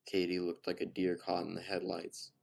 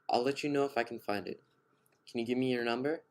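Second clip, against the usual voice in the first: put-on a touch higher, friendly young woman; lightly voiced Katie; 5 semitones higher, 4 dB louder than usual. A narrator's reading. Narrator voicing Katie to the stranger, lightly higher and friendly. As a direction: brightly offer to help, a bit flustered.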